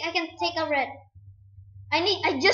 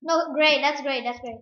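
usual speaking voice

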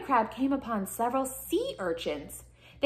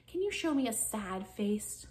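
ordinary delivery, nothing marked

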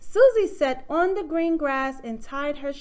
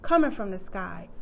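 ordinary delivery, nothing marked

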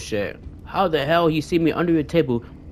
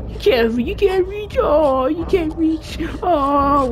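very high, teasing sing-song; gloating squeaky taunt at the stuck enemy; mocking taunt; 13 semitones higher than usual. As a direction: jeer up high that he can't reach.

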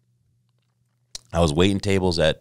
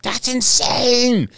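slow high amazed cry; 6 dB louder, much slower than usual. Retold or imitated. Roommates hearing about the job offer, loud amazed cry.

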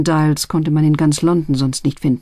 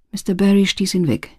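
plain reading voice

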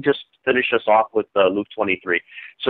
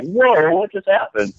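startled; 9 semitones higher, slower than usual. Retold or imitated. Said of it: "I'm like".

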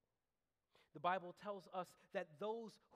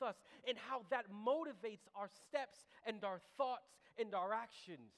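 emphatic, raised sermon voice; impassioned preaching; 7 semitones higher, 7 dB louder than usual. Real, not acted. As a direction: drive each phrase home to the congregation.

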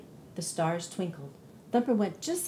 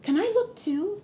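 much higher, hopeful ask; eager bunny; 11 semitones higher, 4 dB louder than usual. Acted. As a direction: plead to join in. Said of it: "Thumper asked".

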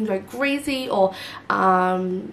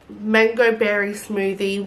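ordinary talk

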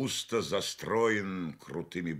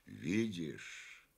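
slow, lazily stretched, softer; drawn-out lazy word; 6 dB quieter than usual. A narrator's reading. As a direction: stretch it out lazily, almost idly. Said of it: "Повторяет он протяжно и как бы лениво".

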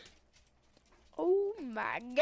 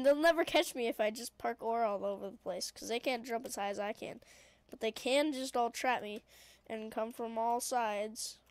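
normal talking voice

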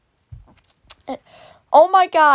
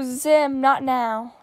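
usual speaking voice

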